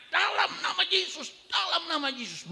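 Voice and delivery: high proclaiming of dalam nama Yesus; commanding prayer. Retold or imitated. Pastor laying hands on the man, high commanding prayer. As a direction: press the prayer home, high.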